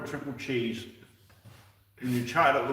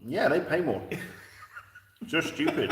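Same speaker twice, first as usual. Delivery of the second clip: usual speaking voice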